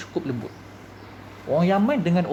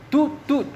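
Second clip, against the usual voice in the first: high, drawn-out "tut" bleeps; imitated censor beep; 9 semitones higher, 3 dB louder than usual. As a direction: bleep it out with your voice. Voices censor beeps over an insult. (tiruan bunyi bip sensor, nada tinggi)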